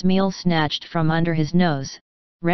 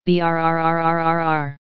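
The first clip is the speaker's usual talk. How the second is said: a long, steady lip-trilled brrr; shivering brrr. A narrator's reading. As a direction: shudder through the sound as if cold with fear. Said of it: "I shiver at the mere thought".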